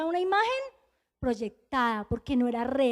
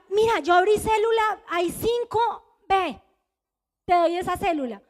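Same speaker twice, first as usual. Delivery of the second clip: raised, higher voice giving brisk orders; urging; 4 semitones higher, 5 dB louder than usual. Retold or imitated. Juan telling her to take his cell, loud urging. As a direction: call it out to send someone off.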